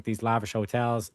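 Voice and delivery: ordinary delivery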